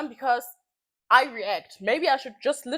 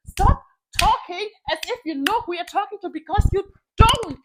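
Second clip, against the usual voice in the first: angry; 7 semitones higher, 5 dB louder than usual. Retold or imitated. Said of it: "where I'm like".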